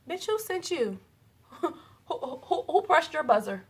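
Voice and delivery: high, sassy who-sent-you retort; sassy comeback. Retold or imitated. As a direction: fire back at her with high mock disbelief. Sassy comeback to a rude comment.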